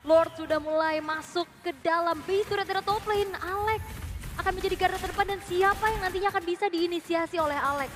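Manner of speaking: ordinary talk, nothing marked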